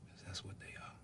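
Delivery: soft unvoiced whisper at a bedside; hushed whisper so the boy can rest. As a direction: breathe the words to a sick child. Father at dying son's bed.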